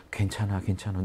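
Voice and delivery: whispering comfort to a restless mind; soft self-soothing. Acted. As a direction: coax yourself gently. Ego soothing itself, acted quote.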